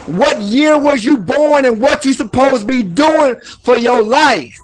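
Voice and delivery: fired-up street sermon on hustle; motivational preaching. Acted. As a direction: preach hard to the camera.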